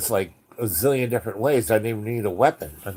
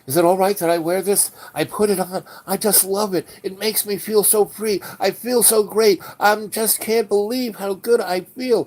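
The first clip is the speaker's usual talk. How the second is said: higher, fast and breathless with joy; gushing; 8 semitones higher than usual. Retold or imitated. Yos telling the wife he loves her clothes, high and fast. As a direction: gush like he's finally free.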